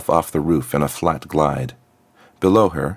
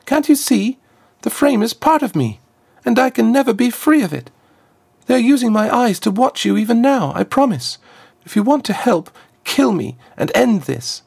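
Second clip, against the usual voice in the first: put-on much higher, urgent pleading girl; desperate trapped girl. Trapped girl urges Azriakam to kill her, high and desperate. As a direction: beg her to end it all.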